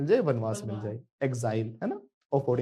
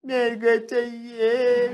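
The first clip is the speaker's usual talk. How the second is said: loud, high tearful mock whine; whining child; 6 semitones higher, 6 dB louder, much slower than usual. Shown as a demonstration. Teacher shows class how kids cry while talking, whining. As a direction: imitate a child wailing a demand, words dragged out. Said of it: "रोते रोते बोलेंगे".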